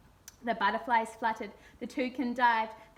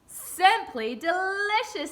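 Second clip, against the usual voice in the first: higher, louder, slow chorus cry; eager cry; 7 semitones higher, 6 dB louder, slower than usual. A narrator's reading. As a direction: cry it out hungrily together. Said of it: "they cried".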